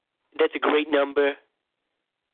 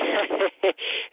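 high laugh; 6 semitones higher than usual. Acted. Laughs at own joke.

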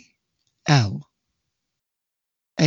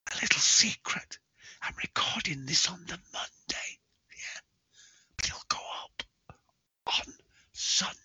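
hushed whispered aside, much softer; secretive whisper; 3 semitones higher, 10 dB quieter, faster than usual. Pretend. Confiding a secret to listeners.

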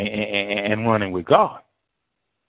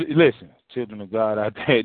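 ordinary delivery

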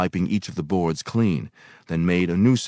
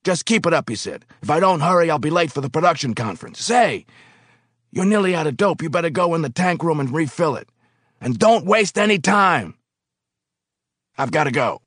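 higher, louder hurried foreman; hurried boss; 11 semitones higher, 5 dB louder than usual. Acted. Foreman hurrying off, ordering the new worker to refill. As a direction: rush the orders out like a busy boss.

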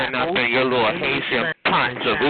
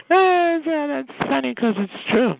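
laughing; 11 semitones higher than usual. Real, not acted. Laughing at own joke.

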